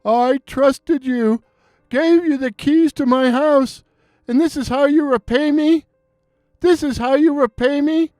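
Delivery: a wronged man crying out, rising to a shout; trembling emotional outcry. Acted. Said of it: "in a voice trembling with emotion, cried out".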